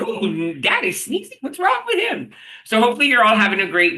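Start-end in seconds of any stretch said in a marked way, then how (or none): 0.0-2.3 dog voice. Retold or imitated. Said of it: "He's like".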